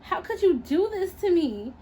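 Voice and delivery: a touch of hurt anger; angry. Acted. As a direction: hurl the betrayal at her. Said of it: "with anger in her eyes".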